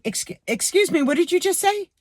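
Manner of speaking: high, disbelieving excuse me; rising in shock at the rudeness; can't believe what she heard; incredulous. Retold or imitated. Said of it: "Because I couldn't believe".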